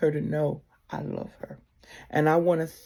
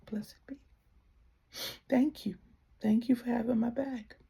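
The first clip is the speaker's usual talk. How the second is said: soft whispered gratitude; hushed thanks; 5 semitones higher, 8 dB quieter than usual. Retold or imitated. A devotee thanking Chango for support, whispered. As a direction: murmur your thanks under your breath. Thanking the Orisha after reassurance.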